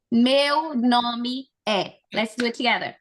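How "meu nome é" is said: Meu nome é, very slow, with é as an open eh; slow model phrase. Shown as a demonstration. Teacher modelling Meu nome é for the class, very slow. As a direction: drag out Meu nome é so the class can follow. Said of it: "It's gonna be é".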